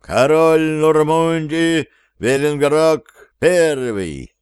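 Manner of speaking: grand entrance announcement. Acted. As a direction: proclaim the new king grandly. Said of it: "торжественно провозгласил".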